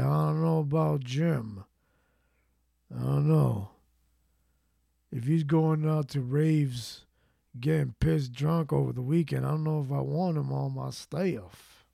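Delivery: lightly voiced doubtful boss in a southern accent. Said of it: "I gave him a southern accent".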